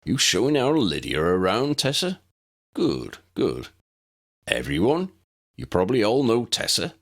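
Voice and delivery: friendly gamekeeper addressing class; a hint of Hagrid. A narrator's reading.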